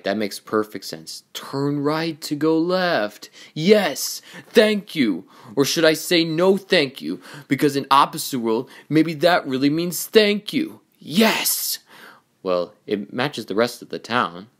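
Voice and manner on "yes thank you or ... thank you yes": high, loud mock gratitude; sarcastic outburst. Racer mocking advice to turn right to go left, sarcastic outburst. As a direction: rant with exaggerated thanks.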